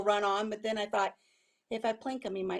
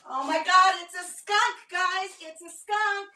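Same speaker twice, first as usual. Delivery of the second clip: alarmed; 6 semitones higher, 5 dB louder, slower than usual. Real, not acted. Skunk spotted in chicken yard.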